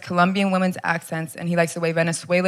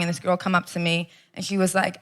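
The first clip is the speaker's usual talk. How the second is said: ordinary talk, nothing marked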